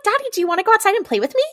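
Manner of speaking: high, rapid imitation of a child pleading; little girl. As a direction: rattle it off in a high kiddie voice. Voicing demon playing little girl.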